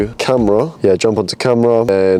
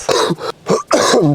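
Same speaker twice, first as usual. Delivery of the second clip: coughing; 8 semitones higher than usual. Real, not acted. Sore throat cough.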